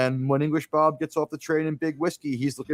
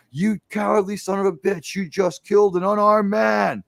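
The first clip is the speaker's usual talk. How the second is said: higher, accusing quoted movie line; movie lawman's outrage; 4 semitones higher than usual. Retold or imitated. Lawman confronting the killer in the bar, imitated outrage. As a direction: declaim it high, punching the accusation. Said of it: "the iconic line that was in the commercial".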